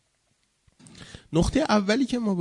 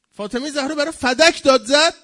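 loud, high cry of protest; impassioned shout; 9 semitones higher, 11 dB louder than usual. Real, not acted. Cries out the point, then repeats softly. (با فریاد و صدای بلند)

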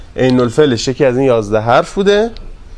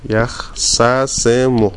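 یخ سسمو stretched very slowly; slow drill; 3 semitones lower, much slower than usual. Shown as a demonstration. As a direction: sound out یخ سسمو syllable by syllable. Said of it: "teacher drills the form". (یخ سسمو را خیلی آهسته و کشیده بگو)

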